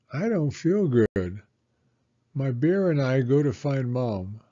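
ordinary delivery

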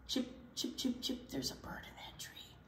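hushed whisper pointing out a bird. Acted. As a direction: whisper it so the bird won't hear. Norman whispers about bird.

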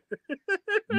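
squeaky high-pitched laugh. Real, not acted. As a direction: burst into a high giggle. Laughs at own bit.

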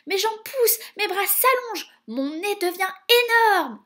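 high, loud, rising alarm; panicked. A narrator's reading. Jacques feeling his body grow, panicked. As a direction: cry out each change in horror.

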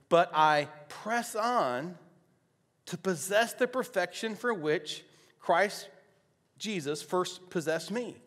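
high, loud, slow preaching delivery; emphatic scripture reading. Real, not acted. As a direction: declaim the verse with weighty pauses, settling at the end. Reads scripture aloud to congregation.

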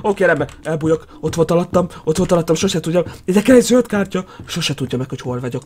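low, soft muttering; hushed hiding voice. Real, not acted. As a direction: keep it down so the hunter won't find you. Hiding from the pursuer in game. (halk, bujkáló motyogás)

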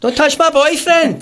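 high laughing mock shriek, slowly; hammed-up woman screeching at the window cleaner.

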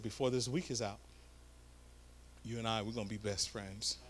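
soft, low, meaningful vow; quiet pointed promise. Retold or imitated. Preacher to the lady, eye to eye, soft and low. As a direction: lean in and say it low and deliberate. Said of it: "and I said".

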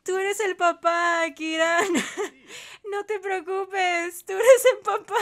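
high, teary reassurance through laughter; crying with laughter. Real, not acted. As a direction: try to reassure while cracking up. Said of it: "estoy llorando".

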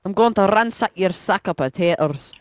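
put-on Irish accent; exaggerated Irish lilt; mock Irish. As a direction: play it in a stage Irish brogue. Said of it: "speaking Irish".

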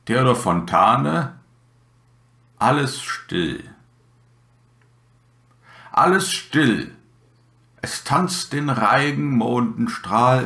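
measured recital, the refrain set apart by pauses; poem recitation. Acted. Podcast host recites a still winter night poem to listeners, measured recitation. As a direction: recite with lifted refrains and falling line ends.

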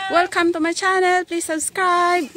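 bright, loud mock vlogger intro; playful. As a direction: ham up the channel greeting.

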